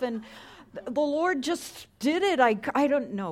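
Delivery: high, loud whining excuses; hammed-up defensive whine; whiny excuse-maker. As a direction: plead your innocence like a guilty kid. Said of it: "You know what I don't hear?".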